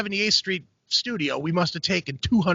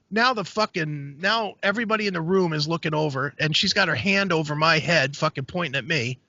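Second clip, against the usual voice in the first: ordinary delivery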